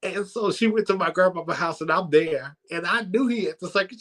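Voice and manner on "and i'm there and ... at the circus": normal talking voice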